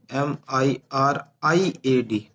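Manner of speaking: slow, letter by letter spelling; teacher spelling the word slowly for students. Shown as a demonstration. Teacher spells the correct word slowly, letter by letter. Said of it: "ये spelling गलत लिखी हुई है".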